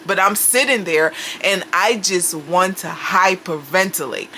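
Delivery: ordinary talk, nothing marked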